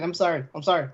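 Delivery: normal talking voice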